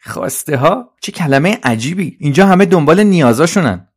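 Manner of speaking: high, swooping, baffled voice; Trolley, puzzled. Acted. Trolley repeats a strange word to itself, high puzzled voice. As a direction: puzzle over it aloud, rising high and loud. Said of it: "ترولی با خودش تکرار کرد".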